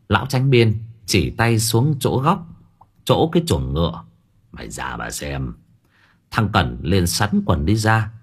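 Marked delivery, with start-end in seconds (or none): 4.5-5.5 low, very soft, drained voice; feeble hushed order. A narrator's reading. Shaken master sends his servant to look, weakly. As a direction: barely push the words out.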